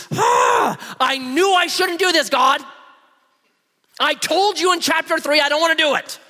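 high, loud exasperated complaint; angry Moses. Acted. Moses ranting at God for forcing him, high angry protest. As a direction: rant at God like fed-up Moses.

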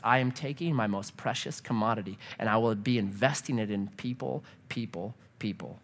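a quiet, intimate resolve; soft fading voice. Retold or imitated. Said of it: "And so Jesus says".

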